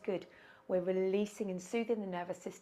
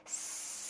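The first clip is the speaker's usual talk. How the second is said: snake hiss; 6 dB quieter than usual. Shown as a demonstration. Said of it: "our hissing like a snake breath".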